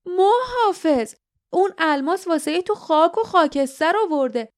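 high-pitched, angry warning; aggressive. A narrator's reading. Lif warning guardian of ruin, aggressive. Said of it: "لیف پرخاش کرد".

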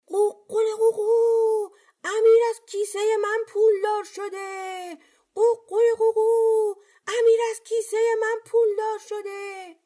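very high, sing-song rooster voice; crowing rooster cry. A narrator's reading. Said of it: "فریاد زد". (فریاد زیر و آهنگین خروس)

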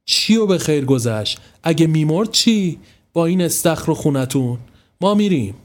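high, indignant outburst; angry. A narrator's reading. Mother blames the hosts for near drowning, angrily. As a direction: throw the words back in anger. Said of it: "با عصبانیت گفت".

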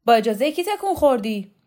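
an official barks at the man who ducked; shouting. Acted. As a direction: shout him down for moving. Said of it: "قهرمان شوکت داد زد".

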